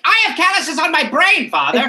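high, huffy, pompous protest; indignant son. Retold or imitated. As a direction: whine back at father like a snob. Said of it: "He was like".

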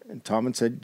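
normal talking voice

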